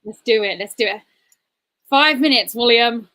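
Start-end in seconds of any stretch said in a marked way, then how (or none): 1.9-3.1 loud call across the room. Real, not acted. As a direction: shout the warning out. Calling out a warning.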